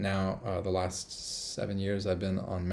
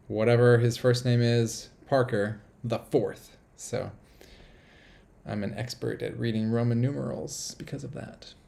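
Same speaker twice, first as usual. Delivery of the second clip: ordinary talk, nothing marked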